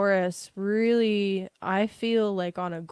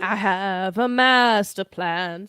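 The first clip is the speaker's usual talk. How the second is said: slow scheming villain voice; sinister master plan voice; 7 dB louder, much slower than usual. Acted. Villain tease, slow put-on voice.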